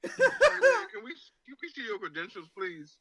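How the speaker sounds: high, loud, nearly crying with laughter; laughing disbelief. Real, not acted. Roaster mocking a podcaster's credentials, laughing helplessly. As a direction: wheeze the question out laughing.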